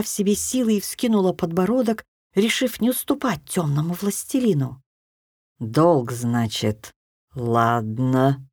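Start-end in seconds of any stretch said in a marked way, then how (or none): 5.6-8.4 low, slow drawling dark lord; drawn out. A narrator's reading. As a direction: stretch it out, low and cool. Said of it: "протянул тёмный". (протяжно и низко)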